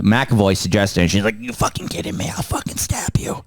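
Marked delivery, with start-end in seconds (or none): 1.4-3.4 soft, unvoiced mock menace; whispered threat. Resentful daughter threatening McAvoy over baby name, whispered threat. Acted angry threat, hissed without voice.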